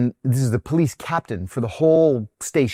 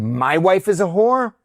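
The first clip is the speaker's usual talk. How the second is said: higher, rising retold question; indignant disbelief; 7 semitones higher, 4 dB louder than usual. Husband answering the driver's insult, indignant. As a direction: throw the insult back as an offended question.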